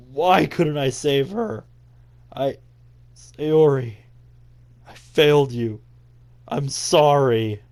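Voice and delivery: acted anguish, loud and drawn out; grief-stricken. Blaming himself for failing to save her, grief-stricken. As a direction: mourn out loud, stretching the words. Acts grief over dead friend.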